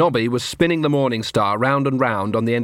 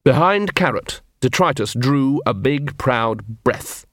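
ordinary delivery, nothing marked

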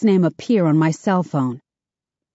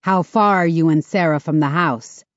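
ordinary delivery

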